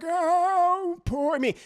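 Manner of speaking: high, mock self-pitying whine; mock the butler's whimper; whiny butler. Mocking the lazy butler's self-pity, high whine.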